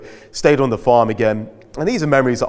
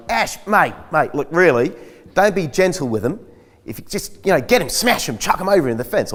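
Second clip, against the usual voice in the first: higher-pitched mock farmer, pushing harder; impatient farm boss; 6 semitones higher than usual. Farmer urging him to be rougher with sheep, mock impatient voice. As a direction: egg him on, a lightly hammed rough farmer. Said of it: "And he's like".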